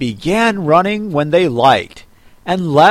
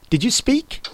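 high, polite question with a frown; frowning politeness; 10 semitones higher, 5 dB quieter than usual. A narrator's reading. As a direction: ask very politely but disapprovingly. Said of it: "frowning but very politely".

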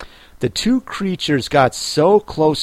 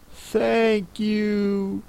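put-on high, soft girl voice; Alice; 8 semitones higher, 7 dB quieter than usual. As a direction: thank them softly in a higher voice.

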